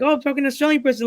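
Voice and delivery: fast, high-pitched mocking voice; mocked coworkers. People at his job meeting an Australian, mocked high voice. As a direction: rattle it off high, like an excited clueless person. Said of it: "they're like".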